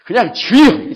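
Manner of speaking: loud, high cry to the Lord; prayer cry. Retold or imitated. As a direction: belt out the prayer call. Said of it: "탁 소리 지르라고". (크게 부르짖듯이)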